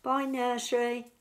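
usual speaking voice

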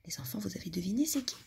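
quick playful whisper. A narrator's reading. Storyteller whispering a riddle to the children.

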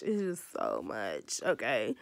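low slow exasperated repeat; heavy sigh of a line, deep and slow; deep, drawn-out exasperated groan-voice. Acted. Venting about editing hassle.

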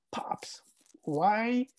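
hushed, voiceless plea to Pops; choked whisper. Real, not acted. Son wishing he could ask his late dad, whispering. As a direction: let the voice give out into a whisper.

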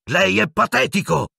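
high-pitched outraged cry; shouted accusation. Acted. Pupil to teacher, high indignant shout. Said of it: "gridò Harry".

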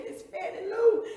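high, very loud distressed reply; cried-out answer. Acted. Beaten woman giving the officer her name, distressed cry. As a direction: cry the answer out under the blows.

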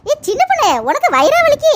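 a touch of a fussing mother-in-law. Acted.

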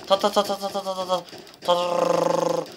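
high rattled gunfire noise; mock gunfire. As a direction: rattle off machine-gun fire, ending in a roll. Imitating gunfire in a game. (yüksek perdeden ağızla silah sesi)

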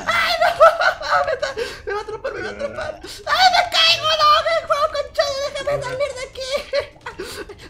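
high loud yells while fleeing; scream-laugh as it grabs at her; hammed-up terror; panicked laughing shout. Monster about to catch her.

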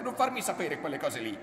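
high, forceful shout of horrified refusal; loud outburst. A narrator's reading. Horrified man cutting off his lover's account, loud and high. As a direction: burst out loudly, pitched well up. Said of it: "disse forte".

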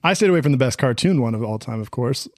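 ordinary talk, nothing marked

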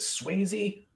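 lightly voiced high guy asking her out; mock proposal. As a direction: ham up a cheesy invitation. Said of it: "he says".

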